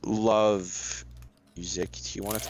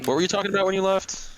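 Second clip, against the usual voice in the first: excited; 10 semitones higher, 4 dB louder, faster than usual. Real, not acted. Teasing question.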